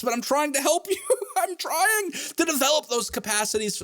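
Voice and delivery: high-pitched, laughing through the words; laughing plea. Real, not acted. Professor joking with students about the hard class, laughing plea. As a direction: protest your good intentions while cracking up.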